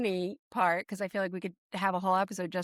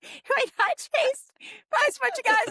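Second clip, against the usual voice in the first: laughing. Real, not acted. Host laughing about Action Park stories, high and giggly. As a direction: squeal it out while laughing.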